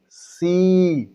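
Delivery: सी said with a long ee. Shown as a demonstration. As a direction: draw out the ee in सी. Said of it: "ई का उच्चारण को लंबा खींचा". (लंबी ई के साथ सी)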